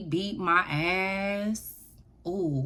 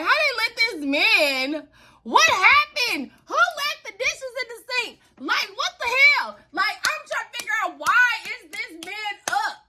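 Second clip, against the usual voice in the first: indignant disbelief; 14 semitones higher, 4 dB louder than usual. Retold or imitated. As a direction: wail your questions in mock outrage, high and stretched. Indignant retelling of being caught.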